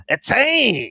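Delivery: a loud, high-pitched, hammed-up Creole-accented "Achane!"; mock Creole accent. Shown as a demonstration. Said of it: "I have no idea what an accent is supposed to be like".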